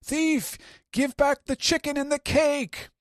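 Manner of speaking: high, loud shriek of thief; screaming. Retold or imitated. Kid catching a thief, high loud screaming. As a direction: shriek an alarm. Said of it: "someone screams".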